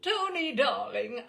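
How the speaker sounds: high, loud trilled greeting; high fluting darling; trilling countess. Retold or imitated. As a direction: trill it like a doting mother. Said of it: "I trilled".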